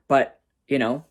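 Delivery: ordinary talk, nothing marked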